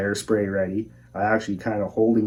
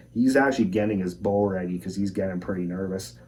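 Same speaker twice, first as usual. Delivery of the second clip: ordinary delivery, nothing marked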